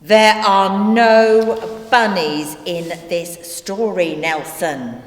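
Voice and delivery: slow, emphatic scolding, easing off at the end; firm exasperation. Acted. Narrator correcting Nelson about bunnies, firmly exasperated. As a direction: spell it out slowly, patience gone.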